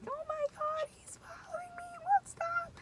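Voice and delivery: high, breathy panicked whisper; panicked retelling. Retold or imitated. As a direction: squeak it out in terror, barely voiced. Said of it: "screaming my head off".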